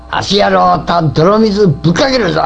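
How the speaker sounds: loud rough threat, shouting; angry shout. Acted. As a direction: bark it out angrily.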